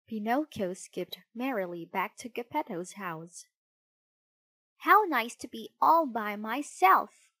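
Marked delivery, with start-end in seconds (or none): 4.8-7.3 higher, louder, pleased delivery; gleeful puppet with a grin; grinning. A narrator's reading. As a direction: say it with smug delight.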